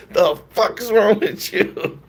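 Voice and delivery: high, drawn-out mock scolding from mom; mad mom. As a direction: imitate an exasperated mother, slow and high-pitched. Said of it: "My mom was mad".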